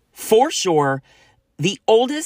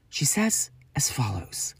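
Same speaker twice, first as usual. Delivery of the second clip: whispered announcement; 6 dB quieter than usual. Real, not acted. Announcing Ali's message.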